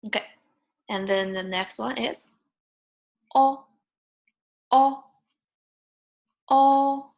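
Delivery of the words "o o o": "o" held high, level and loud; flat first tone. Shown as a demonstration. Teacher drills next vowel, "o" high and flat. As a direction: sustain "o" on one high note. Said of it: "first tone is a flat tone".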